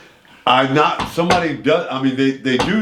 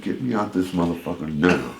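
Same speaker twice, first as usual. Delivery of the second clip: urgent aside; 6 semitones lower, 5 dB quieter than usual. Retold or imitated. Retold urgent aside to producer.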